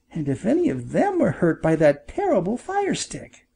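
small, high storybook bird voice; little bird. Acted.

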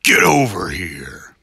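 slow, loud growled call; Scorpion battle cry. Pretend. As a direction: drag it out like Scorpion. Mortal Kombat Scorpion quote.

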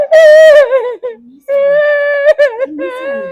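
very slow, loud, high wail; wailing ghost. Acted. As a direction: howl it out like a spirit. Ghost mocks the mother-in-law.

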